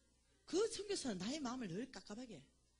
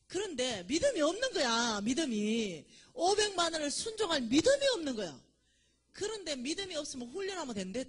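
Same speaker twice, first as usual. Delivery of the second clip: forceful sermon emphasis; emphatic preaching; 6 semitones higher, 11 dB louder than usual. Real, not acted. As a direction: preach it with full force, leaning on 믿음이. Preacher stressing lack of faith.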